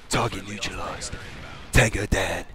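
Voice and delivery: low, soft, clipped radio report; soldier radio callout. Retold or imitated. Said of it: "the little phrase that they use".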